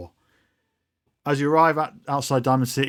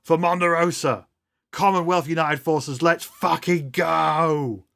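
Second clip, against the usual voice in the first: higher-pitched battle cry, last words drawn out; rally the troops, stretching the final "go"; mock rallying yell; 5 semitones higher, slower than usual. Betsy sending the crowd off to war, acted yell. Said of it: "until Betsy yells out".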